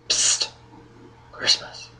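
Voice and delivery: hushed whispered call; psst, voiceless whispered name; urgent whisper. Acted. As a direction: whisper to wake him secretly. Secretly calling a sleeping companion.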